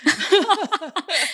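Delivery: short high laugh that falls away; bright burst of laughter. Real, not acted. Laughs at friend's proud smile.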